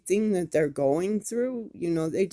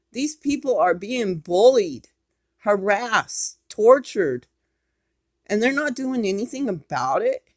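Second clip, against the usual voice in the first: outraged; 4 semitones higher, 6 dB louder than usual. Real, not acted. Outraged rant about harassment.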